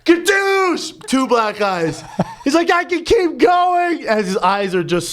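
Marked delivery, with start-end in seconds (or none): 0.0-1.1 high-pitched hammed-up defiance; mock frantic threat. Friend hitting himself to spite his girlfriend, mock frantic threat. 2.7-4.1 high, slow, drawn-out mock yell; mock defiant yell. Friend punching his own eyes at his girlfriend, mock defiant yell.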